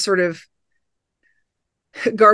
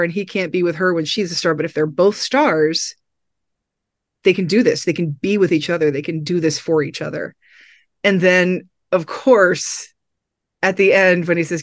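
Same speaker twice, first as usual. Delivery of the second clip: normal talking voice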